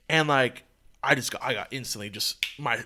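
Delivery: ordinary delivery, nothing marked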